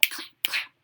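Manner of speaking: soft, toneless rhythmic whisper; whispered cue. Shown as a demonstration. Reader cues children to clap twice, whispered. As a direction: whisper the two beats.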